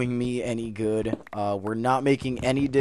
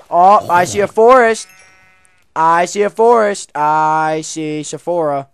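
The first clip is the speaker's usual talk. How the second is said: loud, higher, joyful call; excited shout; 6 semitones higher, 16 dB louder than usual. Real, not acted. Gamer thrilled to finally find a forest, loud. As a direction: shout it like spotting land.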